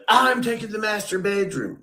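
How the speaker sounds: high, smug mock voice of the housemate claiming the best room; mocking Ray. Mocking Ray claiming the master bedroom, high and smug. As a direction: sneer it out like a spoiled man grabbing the best room.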